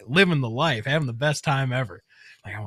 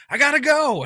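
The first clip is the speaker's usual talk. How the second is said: high, loud hurried call back; retold shout; 13 semitones higher, 7 dB louder than usual. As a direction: shout it back as you take off. Son shouting back while fleeing.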